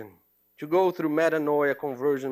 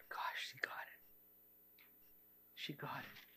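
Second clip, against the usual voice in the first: hushed, breathless realisation; awed whisper; 2 semitones lower, 24 dB quieter than usual. Retold or imitated. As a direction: whisper it in quiet wonder. Awed aside about the student.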